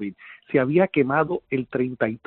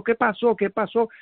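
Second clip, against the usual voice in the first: higher-pitched, repeated urgent asking; retold urgent question; 6 semitones higher than usual. Survivor reenacting questioning the injured man, raised urgent voice. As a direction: ask in alarm, voice pitched up.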